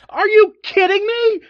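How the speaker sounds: loud, high, fed-up cry of disbelief; exasperated outburst. Acted. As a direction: blurt it out, furious at being cut off. Reporter angry at interruption.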